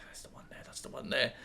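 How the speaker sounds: whispered. Retold or imitated. Fans on the platform spotting him, whispered. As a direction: whisper it like girls pointing him out. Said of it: "I can see them going".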